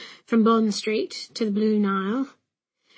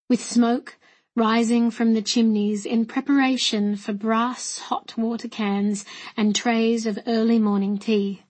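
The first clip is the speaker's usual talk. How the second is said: ordinary delivery, nothing marked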